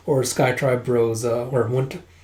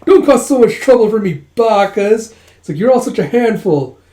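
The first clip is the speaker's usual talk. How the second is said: high, loud exasperated scolding; 7 semitones higher, 6 dB louder than usual. Acted. Yun scolds the group for the trouble. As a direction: scold them like a fussing parent.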